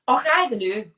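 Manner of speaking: put-on a light Scottish accent. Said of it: "say the most Scottish thing you can think of in a Scottish accent".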